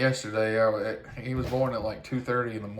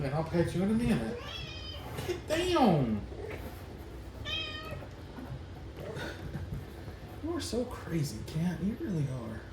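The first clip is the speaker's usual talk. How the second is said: much higher sing-song voice for the cat; pet talk; 8 semitones higher, 4 dB quieter than usual. Real, not acted. Telling the cat Phoebe to move, in high pet talk. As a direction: coo at a pushy cat while you work.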